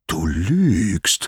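drawn-out accusation at normal pitch; measured, stern rebuke; contained accusation. Acted. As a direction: hold back the anger on the charge. Apollo accuses, first take.

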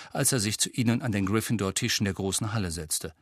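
ordinary delivery

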